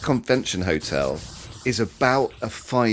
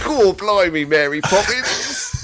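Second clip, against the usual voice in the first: high, hammed-up mock Cockney accent, laughing; 10 semitones higher, 4 dB louder, slower than usual. Host showing the guest how to open his lecture, in a laughing hammed-up mock Cockney accent. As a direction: ham up a fake Cockney accent like a cheeky chimney sweep.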